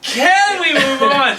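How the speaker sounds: laughing exasperated. Real, not acted.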